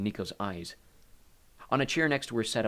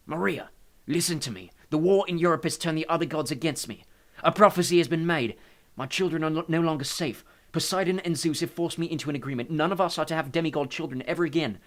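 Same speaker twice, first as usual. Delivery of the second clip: higher, raised, urgent god's voice; agitated Hades; 7 semitones higher, 6 dB louder than usual. A narrator's reading. Hades warns Maria of danger, agitated.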